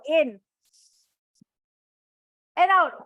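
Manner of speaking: loud exhale on out; big breath in and out. Shown as a demonstration. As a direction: breathe out hard on the word out. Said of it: "leads breathing exercise".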